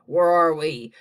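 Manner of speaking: low, loud startled voice; bewildered creature. A narrator's reading. Another creature blinking in sunlight, low and bewildered. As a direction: ask loudly in a low voice.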